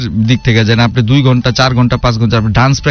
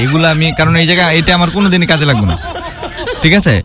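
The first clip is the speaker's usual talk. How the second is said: ordinary talk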